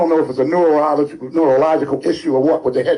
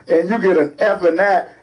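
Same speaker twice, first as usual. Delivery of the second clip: normal talking voice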